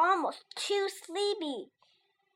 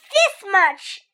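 eager little hare, higher and louder; a bunny showing off proudly; 5 semitones higher, 9 dB louder than usual. Acted. Little Hare stretching arms.

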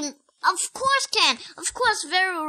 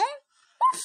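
high, playful woof; puppy bark; 10 semitones higher than usual. Acted. Child barks as Biscuit.

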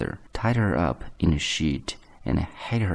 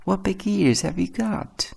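put-on high girlish voice; little girl calling her grandmamma; 12 semitones higher than usual. A narrator's reading. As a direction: pitch it well up like a child. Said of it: "said the little girl".

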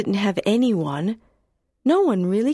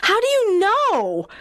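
sharp high demanding question; angrily; 11 semitones higher than usual. Acted. Jenny confronting Paul about Clyreg, high angry question. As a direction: challenge him, upset. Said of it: "I asked angrily".

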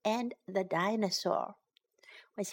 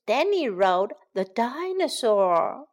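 ordinary talk, nothing marked

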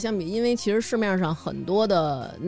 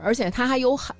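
usual speaking voice

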